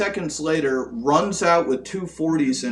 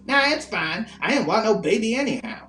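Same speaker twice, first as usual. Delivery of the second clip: lightly voiced crackhead, pitched up; mock carefree dismissal, higher voice; breezy retold crackhead; 7 semitones higher than usual. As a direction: brush it off high and quick. Acted crackhead brushing off sympathy.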